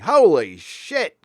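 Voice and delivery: normal talking voice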